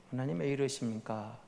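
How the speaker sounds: soft, low sigh of a plea to God; quiet complaint. Shown as a demonstration. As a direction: murmur the complaint under your breath. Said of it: "이런 식으로".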